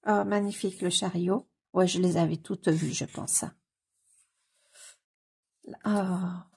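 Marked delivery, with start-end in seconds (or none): none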